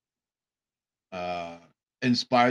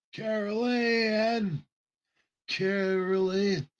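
slow, higher, haunting call; eerie calling; 7 semitones higher, 4 dB quieter, much slower than usual. Retold or imitated. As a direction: call her softly from the other side. Calling the lost daughter.

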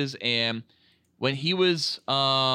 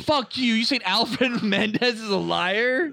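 mock outrage; 7 semitones higher than usual. Mock offence defending Alfred.

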